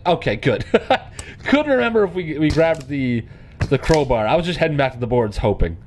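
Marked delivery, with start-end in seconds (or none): none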